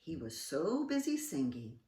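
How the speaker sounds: plain reading voice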